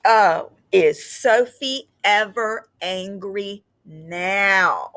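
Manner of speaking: slow, expressive read-aloud with long pauses; reading to children. A narrator's reading. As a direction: read it gently to a class of kids. Teacher reads picture book aloud.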